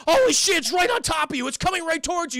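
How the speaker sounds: high, loud alarmed cry; panicked warning. Retold or imitated. Crewmate warning captain the alien is close, panicked.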